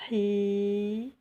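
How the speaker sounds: "Hi" with the EE held out; long vowel. Shown as a demonstration. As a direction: stretch the I of "Hi" evenly. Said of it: "la prolongation avec le ya".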